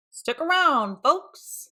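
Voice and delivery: a light call to the crowd. Acted. Peter calls out to the kids, loud and bright. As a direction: call the folks to stay. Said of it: "Peter called".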